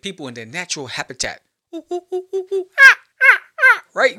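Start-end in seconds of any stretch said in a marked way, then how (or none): 1.7-3.8 rising high oohs and ahs, much louder at the end; hammed-up high excited cooing; mock excited oohs. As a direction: squeal like you spotted something rare. Mimicking people-watching excitement.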